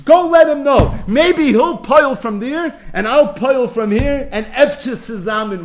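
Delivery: loud, high, pressing command; urgent shout. Acted. Moses sending Elijah off with a loud urgent order. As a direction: rouse him, shout the order out.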